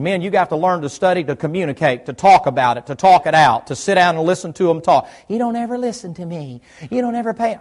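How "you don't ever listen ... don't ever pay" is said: a hint of a complaining wife, higher and softer; nagging wife. Wife complaining to her husband that he never listens, lightly voiced. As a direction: whine it like she's fed up.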